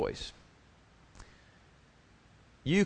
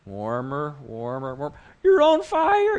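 teasing hide-and-seek voice; 3 semitones higher, 5 dB louder, slower than usual. Retold or imitated. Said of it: "He's not, like, going".

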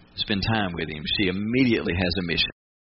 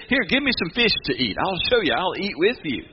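lightly higher, eager voiced Jesus; Jesus offering proof; 7 semitones higher than usual. Preacher voicing Jesus asking for fish, lightly higher and eager. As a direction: coax them with a higher, friendly push. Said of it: "He said".

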